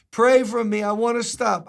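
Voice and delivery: high, loud pleading; street man's voice. Retold or imitated. Street man begging his pastor for prayer, high and loud. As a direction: beg the pastor to pray. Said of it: "That's how he talked".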